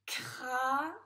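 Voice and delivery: "Cravate" sounded out loudly: crrra... vvva... te; syllable-by-syllable reading. Shown as a demonstration. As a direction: decode "Cravate" slowly, long pauses between syllables. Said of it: "Allez, on corrige". (« Cravate » déchiffré fort, syllabe par syllabe, sons allongés)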